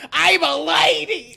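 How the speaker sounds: slow New York lady, drawn out; brassy Brooklyn "I'm a lady"; New York accent. Retold or imitated. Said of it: "fucking New York accent".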